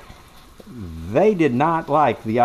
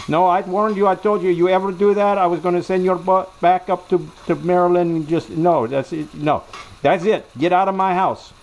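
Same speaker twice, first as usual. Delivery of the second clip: angry wife; 7 semitones higher than usual. Retold or imitated. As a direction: throw him out of the house. Wife throwing husband out.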